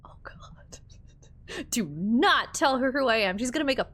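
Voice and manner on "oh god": very soft whisper; whispered dread. Real, not acted. Player dreading the girlfriend's question, whispered dread. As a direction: whisper it under your breath in dread.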